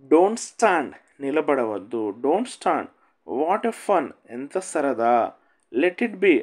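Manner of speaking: normal talking voice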